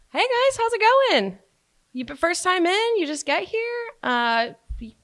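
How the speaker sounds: high, slow server greeting, pitched well up; sing-song hello to new guests; customer service voice. Shown as a demonstration. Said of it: "my customer service voice".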